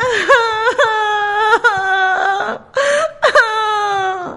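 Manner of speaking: high hammed-up cub wailing; storyteller's playful fake cry; mock crying. A narrator's reading. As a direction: pretend sobs of a little bear. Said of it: "se echó a llorar".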